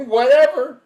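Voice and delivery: a high, loud laughing "Whatever"; laughing dismissal. Retold or imitated. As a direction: brush it off mid-laugh. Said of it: "We're like".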